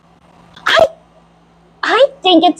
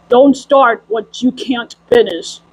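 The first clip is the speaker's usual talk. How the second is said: put-on lower, slow, grave old master; stern sorcerer; 6 semitones lower, slower than usual. Sorcerer scolds his little helper, low and slow. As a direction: hand down the warning like a wise wizard.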